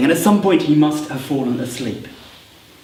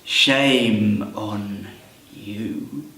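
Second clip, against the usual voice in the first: ominous goblins; 6 semitones lower, much slower than usual. Acted. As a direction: stretch it out, letting the pitch fall. Goblins threaten the intruder.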